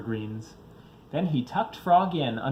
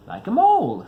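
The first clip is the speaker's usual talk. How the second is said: eager; 8 semitones higher, 10 dB louder than usual. Acted. Mole's eager suggestion.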